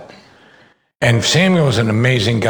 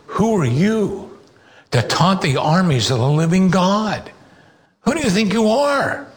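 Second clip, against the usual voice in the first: put-on a boy calling out a giant, unafraid; young David defying Goliath, pitched high; defiant challenge; 7 semitones higher than usual. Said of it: "And David's defiant".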